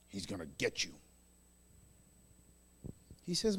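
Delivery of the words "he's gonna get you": low, soft menacing aside; religious spirit warning. Acted. Religious spirit to believer, in a low hushed warning. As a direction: warn them in a hushed voice. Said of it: "the religious spirit will teach you".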